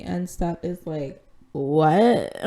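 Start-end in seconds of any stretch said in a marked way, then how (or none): 1.5-2.4 retold dumbfounded one-word reply; mock bewilderment. President hears about a speech from secretary, mock bewilderment. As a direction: imitate someone caught off guard. Acted overwhelmed reaction to secretary.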